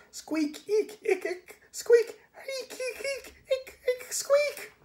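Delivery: very high squeaking chatter with a giggle; shrill rodent babble; excited rat squeaking. Acted. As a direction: chatter the whole story in squeaks. Rat pantomimes the story.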